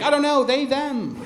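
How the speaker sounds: ordinary delivery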